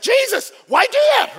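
high, loud, drawn-out exasperated yelp; jump, then scold him loudly for the scare; shrieked mock protest at a prankster; startled shout. Mary startled by Jesus in story.

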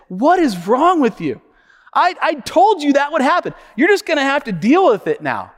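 emphatic pretend telling-off; mock scolding. Father to his injured son, mock scolding. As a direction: play a parent with no sympathy at all.

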